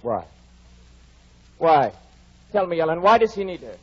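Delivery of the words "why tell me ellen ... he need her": louder, pressing demand. Acted. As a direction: force the question out. Heathcliff demands why Edgar needs Isabella.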